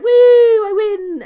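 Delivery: high, loud, slow victory woo; mock celebration. Acting out McAfee celebrating his win, loud and high. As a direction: crow the win like a hammy wrestler.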